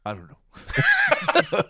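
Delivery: high drawn-out laughing cry. Real, not acted. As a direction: laugh through the words, slowly. Stumped by geography question.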